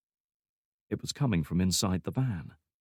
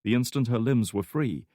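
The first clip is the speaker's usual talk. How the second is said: usual speaking voice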